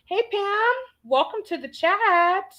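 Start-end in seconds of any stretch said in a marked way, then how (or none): none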